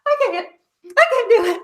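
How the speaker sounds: very high, laughing helpless wail; whiny mock protest. Mother refusing to push, high laughing whine. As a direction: play yourself refusing to go on. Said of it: "I was like".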